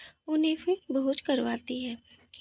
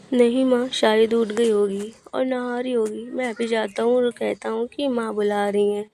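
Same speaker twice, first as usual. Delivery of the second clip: lower-pitched voice for the son; placating son; 4 semitones lower, 6 dB louder than usual. Acted. Son covering for his sleeping wife to mother, placating. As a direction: reassure mother in the son's low voice.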